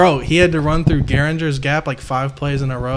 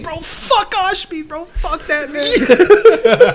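high, drawn-out mock jeer breaking into laughter; imitated laughing; 14 semitones higher, 5 dB louder, much slower than usual. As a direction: imitate a teammate cracking up, very high and slow. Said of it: "he's just laughing".

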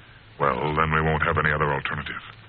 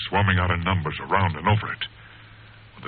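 ordinary delivery

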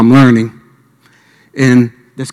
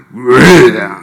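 a mock animal growl, a little louder; hammed-up snarling growl; imitated growl; 4 dB louder than usual. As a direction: growl at the listener like the man did. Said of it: "Like that, something like that".

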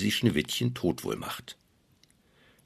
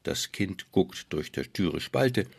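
plain reading voice

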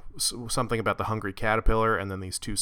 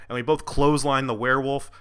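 ordinary delivery

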